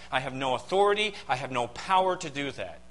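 normal talking voice

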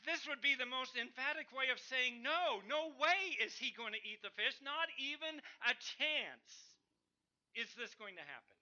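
fiery pulpit emphasis; preaching at full force. Acted. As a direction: hammer the no home.

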